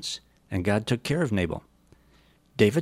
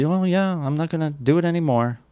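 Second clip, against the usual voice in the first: much higher, offhand mock Saul; breezy promise; 8 semitones higher, 3 dB louder than usual. Saul brushing off David's charge, high and airy in mockery. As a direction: toss off an empty promise, mocking.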